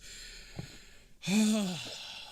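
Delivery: long, audible exhale; weary sigh. Acted. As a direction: sigh heavily before giving in. Guard reluctantly gives in.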